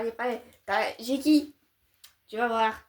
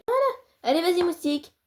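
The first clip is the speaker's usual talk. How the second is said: high cheerful; 7 semitones higher, 4 dB louder than usual. Acted.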